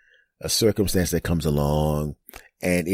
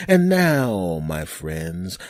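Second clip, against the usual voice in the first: ordinary talk, nothing marked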